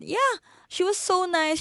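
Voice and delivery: high-pitched gushing echo. Shown as a demonstration.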